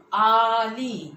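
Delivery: आली very slow, ee held long; आली with both vowels drawn out. Shown as a demonstration. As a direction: stretch आली, lingering on the lee. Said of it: "दीर्घ उच्चार झाला आपला".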